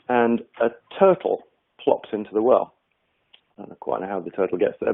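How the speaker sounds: ordinary talk, nothing marked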